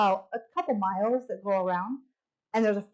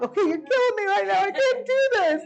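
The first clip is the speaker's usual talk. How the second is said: overwhelmed; 11 semitones higher, 4 dB louder, faster than usual. Retold or imitated. Overwhelmed by a touching gift.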